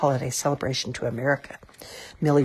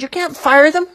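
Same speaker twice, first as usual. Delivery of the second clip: high, loud outcry; crying out; 13 semitones higher, 9 dB louder than usual. A narrator's reading. Millie defends Papa and his friends, crying out. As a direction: protest at the top of the voice. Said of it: "Millie cried".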